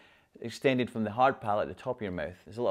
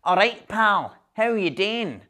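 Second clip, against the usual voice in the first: high, loud, nasal Glaswegian greeting; nasal Glasgow accent; 9 semitones higher, 6 dB louder, slower than usual. Retold or imitated. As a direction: lay on broad Glaswegian, right through the nose. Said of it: "sound a wee bit like this".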